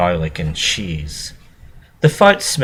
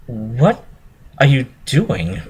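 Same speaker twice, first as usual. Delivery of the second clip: aghast; 6 semitones higher, slower than usual. A narrator's reading.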